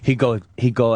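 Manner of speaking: ordinary talk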